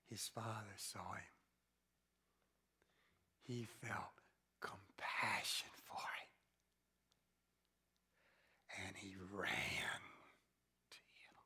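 whispered scripture retelling; soft whispered narration; hushed reading. A narrator's reading. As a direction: whisper it tenderly. Hushed retelling of father's compassion.

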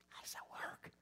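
soft whispered question. Real, not acted. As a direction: breathe the question out.

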